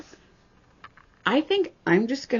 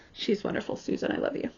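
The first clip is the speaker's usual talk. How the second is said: ordinary talk, nothing marked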